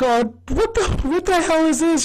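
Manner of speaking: high-pitched bewildered outburst; Charlie. Retold or imitated. Charlie reacts to D's new character, high and baffled. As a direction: squawk it in mock disbelief. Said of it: "Charlie's like".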